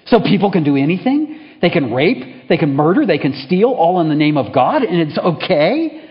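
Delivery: loud, rising indignant questions; indignant challenge. Acted. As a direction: hurl each accusation. Dying man challenges the chaplain.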